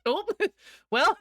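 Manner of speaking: high burst of laughter; laughing. Real, not acted. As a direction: crack up laughing. Reacting to chat joke.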